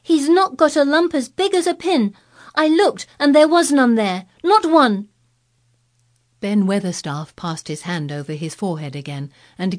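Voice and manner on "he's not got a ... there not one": put-on high, loud indignant girl; almost shout it up a wall; heated girlish defence, pitched right up; fierce indignation. A narrator's reading. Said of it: "almost shouting up the wall in her fierce indignation".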